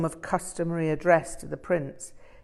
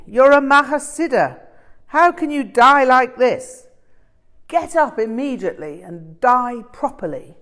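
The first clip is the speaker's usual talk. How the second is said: higher, much louder scolding of a dead master; enraged tirade; 6 semitones higher, 11 dB louder than usual. A narrator's reading. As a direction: hammer every line with fury. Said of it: "addressed the corpse with enormous force".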